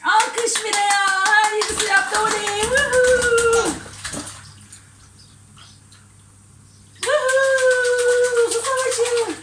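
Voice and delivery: high, loud whoop then a slow cheer; excited whoop. Real, not acted. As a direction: whoop with delight at the splashing. Cheering child's splashing.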